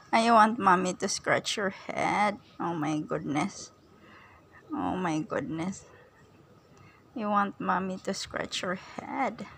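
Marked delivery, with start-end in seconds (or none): 2.6-9.5 low, slow and soft; soft cooing. Real, not acted. Owner coos to her dog while scratching its head, slow and soft. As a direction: coo it gently, drawing out each word. Baby talk to her pet.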